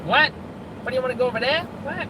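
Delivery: high, whiny Jersey voice; whiny Jersey accent. Retold or imitated. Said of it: "very whiny, the voices".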